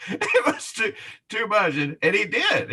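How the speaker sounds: words bubbling through laughter; laughing. Real, not acted. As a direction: giggle it out high. Still laughing.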